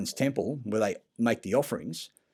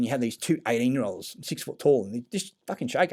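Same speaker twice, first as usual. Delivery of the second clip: normal talking voice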